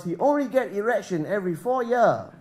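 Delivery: lightly mimicked Chinese-accented English; grandfather's accent. Comic mimicking his Chinese grandfather, light put-on Chinese accent. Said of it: "And my grandfather".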